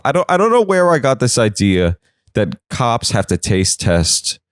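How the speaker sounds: normal talking voice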